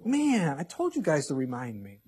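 a light parody of a forgetful God. Pretend. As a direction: play God as a scatterbrained boss who forgot. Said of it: "You think God's going".

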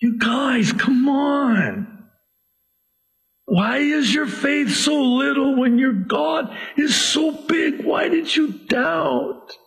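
high, earnest pleading with the disciples; gently urging them to trust; compassionate plea. Acted. Jesus to his doubting disciples, caring and pleading. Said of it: "with a compassion and a love and a care in the tone of his voice".

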